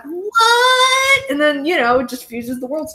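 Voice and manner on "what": long, high rising squeal of disbelief; stretched, high-pitched mock shock; mock astonishment. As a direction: imitate a stunned crowd, pitched way up and drawn out. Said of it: "everybody be like".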